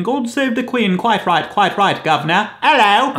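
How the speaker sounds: hammed-up posh British; a put-on English gent; mock British accent. Mocking Brits, hammed-up British accent. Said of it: "drinking tea and saying".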